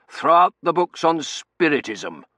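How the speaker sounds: blustering master of the house; commanding colonel. A narrator's reading. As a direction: order the butler emphatically. Colonel orders the butler.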